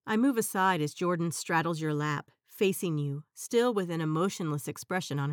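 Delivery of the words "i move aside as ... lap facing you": plain reading voice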